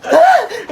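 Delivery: shocked high gasp; sharp high gasp. Acted. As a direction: gasp in alarm. Gasping at child.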